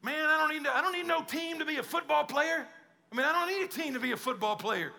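hammed-up indignant excuse; mock boastful player. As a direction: imitate a cocky guy waving off his team. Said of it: "it's like that".

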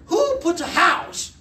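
high, slow disbelief; incredulous. Real, not acted. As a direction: ask it in utter disbelief. Incredulous question about house.

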